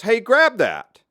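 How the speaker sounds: high, loud, drawn-out call; imitated shout from a machine operator; retold yell. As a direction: yell an urgent order across the shop floor. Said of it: "this guy yells".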